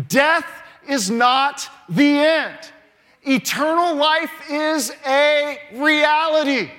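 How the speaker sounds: loud, slow proclamation; preaching climax. Acted. Preacher proclaiming eternal life to the congregation, slow and loud. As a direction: hammer home that death is not the end.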